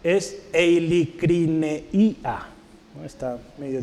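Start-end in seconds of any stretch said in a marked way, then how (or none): 0.5-2.5 very slow, stretched-out eilicrineia; sounding out. Shown as a demonstration. Preacher teaching a hard Greek word, sounding it out slowly. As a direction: read eilicrineia out slowly, syllable by syllable.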